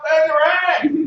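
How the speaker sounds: high, loud, slow shouted cue; host demoing the shout, slow and loud. Shown as a demonstration. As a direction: drag out the cue word. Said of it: "I want you to shout".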